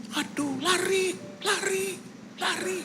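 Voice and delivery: very high, stretched mock urging; imitating wife's cries. Preacher mimics his wife urging soap characters to run, high cries. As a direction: squeal it like an engrossed soap viewer. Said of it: "Dia bisa begini".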